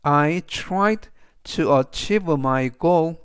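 every word spoken slowly and clearly; slow model reading. Shown as a demonstration. Teacher reading the example sentence to students, slow and clear. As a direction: read the sentence slowly for learners. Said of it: "그래서 전체적 의미는".

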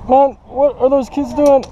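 usual speaking voice

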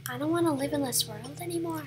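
soft, quick repeat; hushed, half-hearted lament; quiet mock despair. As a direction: say it small and resigned. Repeats the line softly.

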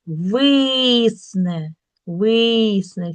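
"висне" with a long drawn-out И; stress on И. Shown as a demonstration. Teacher making the vowel heard, stressed И. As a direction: stretch the first syllable of "висне". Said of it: "чуємо".

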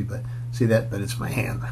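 plain reading voice

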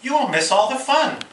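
pleading Frog. A narrator's reading. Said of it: "cried Frog".

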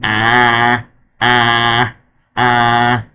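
short vocal imitation of a siren, ah ah ah; a quick siren impression to show how it goes; mock siren wail. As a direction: mimic the siren's wail with your voice. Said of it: "they sound like this".

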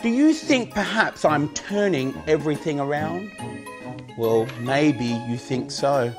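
loud, high showman patter; kids party magician. Acted. Magic show for children.

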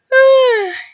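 long loud yawn rising then falling; big yawn. Acted. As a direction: stretch into a sleepy yawn. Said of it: "I don't know why I'm yawning".

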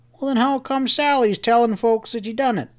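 high-pitched, insistent cowboy questioning; agitated Sam. A narrator's reading. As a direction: press for answers in a high rustic voice.

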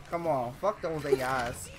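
ordinary delivery

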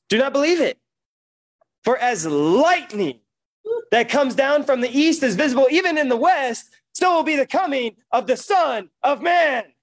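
loud, high, fervent preaching; preaching at full force. Real, not acted. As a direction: preach it out with fire.

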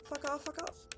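normal talking voice

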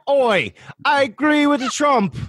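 high, slow imitation of a fake British accent. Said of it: "did she throw on her fake British accent".

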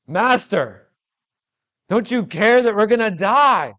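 high, loud desperate plea; panicked cry. Acted. Disciples crying out to sleeping Jesus, high and loud. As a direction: break the calm with a frightened shout. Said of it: "all of that's broken up with".

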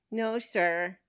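lightly voiced child answering politely; little girl. Costumed girl denying too much candy, light child voice.

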